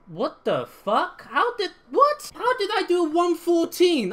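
high, slow disbelieving outcry; raging gamer. Real, not acted. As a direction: shout in baffled disbelief. Baffled by impossible lap times.

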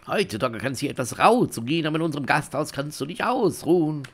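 lightly acted welcoming innkeeper; a touch of lilting host's patter; innkeeper character. Innkeeper offering the traveler a rest, lightly hammed-up hospitality.

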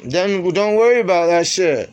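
loud, high dismissive shout; angry shout. Real, not acted. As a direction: bark it back at her. Argument ends.

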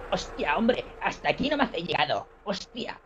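mock Castilian movie-dub Spanish; hammed-up peninsular Spanish accent; Spain accent. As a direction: imitate a film dubbed in Spain. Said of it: "en español de España".

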